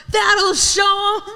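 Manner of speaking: high, slow mock shout; smug gloating quote. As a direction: gloat it out triumphantly. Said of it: "I'm like".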